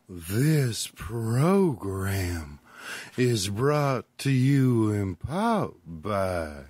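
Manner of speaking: announcer voice. Acted. Mock radio sponsor announcement.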